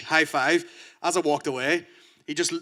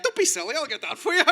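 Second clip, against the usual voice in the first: eager fan; 10 semitones higher than usual. Pretend. Comedian imagining himself helping his hero, eager and higher. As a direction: gush like a starstruck helper. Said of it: "I'd have been like".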